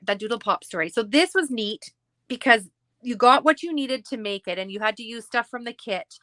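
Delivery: normal talking voice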